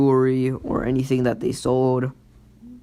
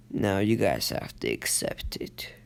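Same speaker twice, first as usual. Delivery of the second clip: menacing whisper; 4 semitones lower, 6 dB quieter than usual. Retold or imitated. Menacing monster speech.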